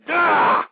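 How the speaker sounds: loud, high exasperated "ugh"; angry grunt. Real, not acted. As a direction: explode in a growl of frustration. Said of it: "I'm sorry if I sound a little angry".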